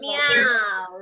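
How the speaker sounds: drawn-out meow; cat meow. Shown as a demonstration. Teacher showing children the cat's sound, meowing. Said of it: "what does the cat".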